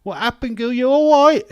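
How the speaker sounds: very loud, high London screech; over-the-top shouted London accent; hammed-up London accent. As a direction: yell the catchphrase in broad London. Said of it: "That's, uh, off TikTok".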